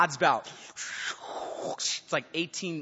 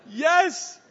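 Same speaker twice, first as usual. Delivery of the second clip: much higher and louder, thrilled; excited cheer; 13 semitones higher, 13 dB louder, much slower than usual. Acted. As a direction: shout for joy at escaping the spanking. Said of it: "You'd be like".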